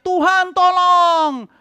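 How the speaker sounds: high, loud, drawn-out cry; crying out for help. Retold or imitated. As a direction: cry out to God in panic. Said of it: "baru kita teriak". (jeritan minta tolong yang panjang dan tinggi)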